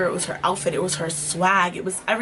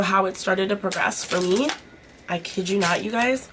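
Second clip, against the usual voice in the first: ordinary talk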